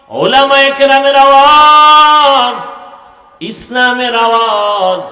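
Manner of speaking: impassioned sermon crescendo with stretched endings; full-force preaching. Acted. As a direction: swell each phrase like a rallying preacher. Preaching, building list.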